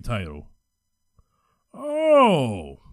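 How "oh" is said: long high "oh" sliding down; sudden realization. Real, not acted. Host finally understanding the boxer's titles, surprised realization. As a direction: let the penny drop in a drawn-out falling oh.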